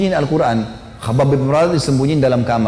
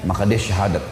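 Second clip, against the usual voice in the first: ordinary talk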